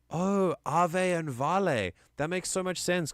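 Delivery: high, drawn-out dawning surprise; light up as it suddenly makes sense; high-pitched, delighted "aha"; delighted realization. Real, not acted. Sudden realization about the Latin.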